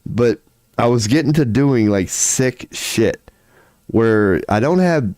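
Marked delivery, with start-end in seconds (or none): none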